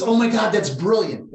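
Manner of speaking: high, loud burst of delight; excited exclamation. Retold or imitated. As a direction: exclaim it like a sudden revelation. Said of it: "he goes".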